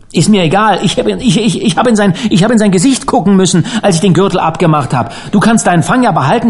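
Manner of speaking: stumbling, fast and high-pitched; agitated insistence. A narrator's reading. Harvey to Dan, having seen the face, agitated insistence. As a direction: rush it out, tripping over words. Shaken Harvey refuses the fish.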